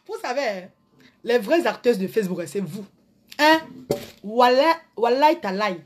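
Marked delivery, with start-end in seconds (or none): none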